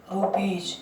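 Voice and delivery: low, soft "रुपीज" with j ending; final J sound. Shown as a demonstration. Teacher answering how it is said, final J sound. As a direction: say "रुपीज" as ru-peej. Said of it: "क्या बोलेंगे इसको?".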